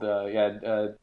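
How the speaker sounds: normal talking voice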